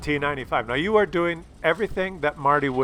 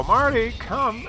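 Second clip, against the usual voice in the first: drawn-out higher call across the field; calling the dog; 9 semitones higher, slower than usual. Retold or imitated. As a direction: call him back slowly, up high. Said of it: "I look at him and I go".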